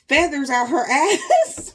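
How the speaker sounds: high, loud, laughing through words. Real, not acted. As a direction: crack up mid-sentence. Laughing at duck story.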